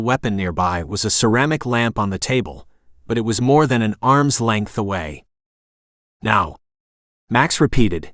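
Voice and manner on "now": ordinary delivery, nothing marked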